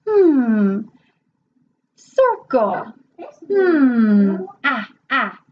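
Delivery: put-on drawn-out letter sounds for a child; phonics teacher demo. Shown as a demonstration. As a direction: sound out slowly and playfully. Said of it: "phonics review demo".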